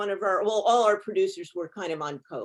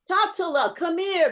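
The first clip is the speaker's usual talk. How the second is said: put-on higher, louder doting old grandpa; 9 semitones higher, 5 dB louder than usual. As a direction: call the kid over like a fond old zayde. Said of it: "seeing him as grandpa also".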